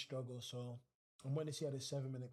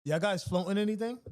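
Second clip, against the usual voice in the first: loud call to the chat, pitched up; louder repeat of a question nobody answered; 6 semitones higher, 9 dB louder than usual. Real, not acted. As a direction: shout it so the room answers. Calling out to silent chat.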